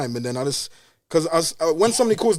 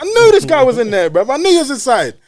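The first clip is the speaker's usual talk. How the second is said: heated shout; 7 semitones higher, 6 dB louder than usual. Retold or imitated. Retold shout at hiding man.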